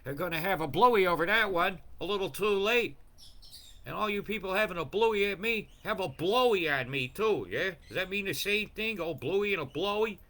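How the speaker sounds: loud, high, heated ranting; in a fucking mood. Real, not acted. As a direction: lash out at the commenters. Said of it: "Yeah, I'm in a fucking mood".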